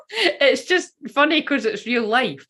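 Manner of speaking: high, laughing through the words; amused and giggling; chuckling while explaining. Real, not acted.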